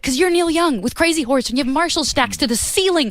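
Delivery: high, frantic panicked outburst; flipped out. Retold or imitated. Nervous singer to [name] backstage, high panic. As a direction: blurt out the panic. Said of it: "I finally just kinda flipped out".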